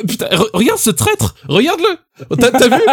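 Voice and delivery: high-pitched scandalised disbelief; hammed-up indignation, voice shooting up; mock outrage. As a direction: play shocked at a traitor.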